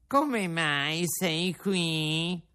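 fox's sly question; fox asking. A narrator's reading. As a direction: ask it slyly as the fox. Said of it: "ripeté il gatto".

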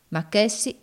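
ordinary delivery

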